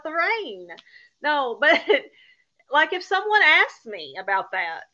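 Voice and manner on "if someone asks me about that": ordinary delivery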